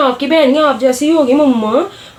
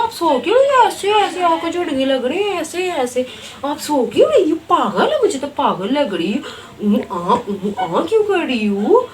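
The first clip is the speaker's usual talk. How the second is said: much higher, prissy mocking voice; snooty modern girl; 4 semitones higher than usual. Modern cousin sneering at her plain relative, high and snooty. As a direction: look down on her like a spoiled city girl.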